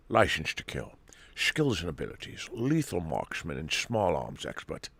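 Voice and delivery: put-on soft, hammy Scottish spy accent; Sean Connery. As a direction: read it like a badly faked Scottish Bond. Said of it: "this is a terrible accent".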